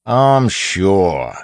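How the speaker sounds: sneering bandit. A narrator's reading. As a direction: brush off the captive's excuse. Bandit disbelieves the captive.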